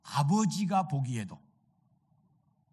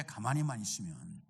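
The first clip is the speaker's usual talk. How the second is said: 가만히만 repeated much lower and softer; quiet echo; 9 semitones lower, 10 dB quieter than usual. Shown as a demonstration. Preacher echoing the phrase to himself, low and soft. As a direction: let "가만히만 있으면" drop to a low murmur. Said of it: "가만히만 있으면 돼".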